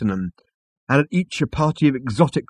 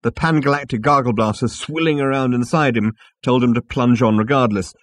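usual speaking voice

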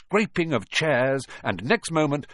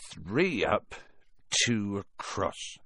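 muttered counting; 3 semitones lower, 5 dB quieter, much slower than usual. A narrator's reading. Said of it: "he muttered".

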